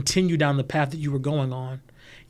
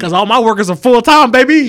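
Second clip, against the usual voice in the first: much louder, higher bragging punchline; exuberant boast; 8 semitones higher, 16 dB louder than usual. Real, not acted. Bragging to his cohost about his team, loud exuberant boast. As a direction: crow it out proudly.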